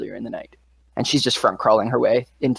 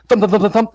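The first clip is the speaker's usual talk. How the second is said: thumping footsteps; 7 semitones higher, 5 dB louder, faster than usual. Retold or imitated. GM voices footsteps overhead.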